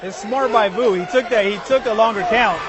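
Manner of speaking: loud excited play-by-play; excited commentary. Real, not acted. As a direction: call the knockdown with rising excitement.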